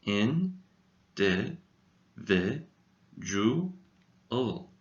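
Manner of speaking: "In, di, vi, ju, ul" split into syllables with a pause after each. Shown as a demonstration. Teacher breaking the word into syllables for learners. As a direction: tap out "In, di, vi, ju, ul" one beat at a time. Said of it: "this little word has five syllables".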